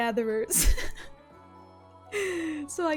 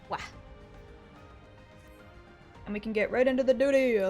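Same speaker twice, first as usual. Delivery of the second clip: ordinary talk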